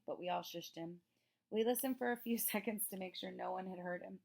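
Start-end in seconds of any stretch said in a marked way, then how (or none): none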